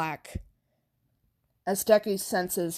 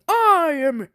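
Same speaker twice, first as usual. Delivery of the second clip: loud, higher heroic call; booming hero entrance; 8 semitones higher, 8 dB louder than usual. Acted. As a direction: announce yourself like a big hero. All Might's heroic entrance.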